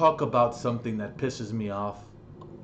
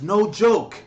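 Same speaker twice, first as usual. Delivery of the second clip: ordinary talk, nothing marked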